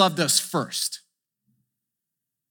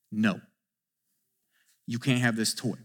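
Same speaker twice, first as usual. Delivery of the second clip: parent's quiet refusal; 9 semitones lower, 9 dB quieter than usual. Retold or imitated. Parent refusing child, retold.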